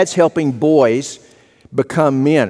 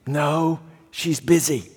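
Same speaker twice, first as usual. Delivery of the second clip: put-on much softer, small-boy voice; hushed little boy; 7 dB quieter, slower than usual. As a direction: answer like a shy four-year-old on the phone.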